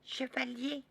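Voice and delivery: high called name; little girl calling. Acted. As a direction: call out like a small girl. Child calls knight.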